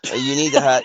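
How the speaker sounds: hiccuping mid-sentence; words broken by a hiccup. Real, not acted. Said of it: "It sounded like a Looney Tune, like, burp".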